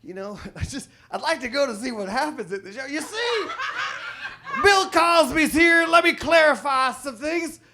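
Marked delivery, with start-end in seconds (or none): 2.8-7.6 Bill Cosby impression. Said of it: "my Bill Cosby impression".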